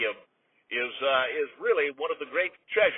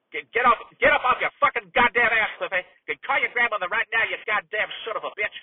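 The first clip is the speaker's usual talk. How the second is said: loud, higher-pitched tirade; angry outburst; 5 semitones higher, 5 dB louder than usual. Acted. Uncle cursing out his nephew on air, furious. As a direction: blow up and berate him. Announcer loses temper at nephew.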